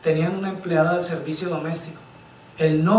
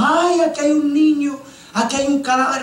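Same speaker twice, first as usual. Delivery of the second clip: high alarmed cry; startled children; 7 semitones higher than usual. Retold or imitated. Children shout that they found a body, high and alarmed. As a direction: cry out at the discovery.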